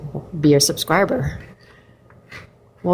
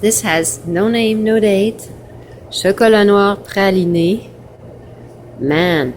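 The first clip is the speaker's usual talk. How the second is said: normal talking voice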